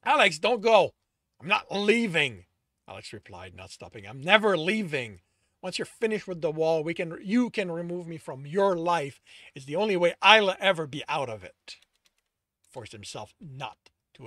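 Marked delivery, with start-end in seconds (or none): 0.0-0.9 louder, higher desperate call; calling after. A narrator's reading. Tristan calls after Alex walking away, pleading loudly. 1.4-11.8 louder, bitter answer; raised reply. A narrator's reading. Alex answers Tristan without stopping, loud and bitter.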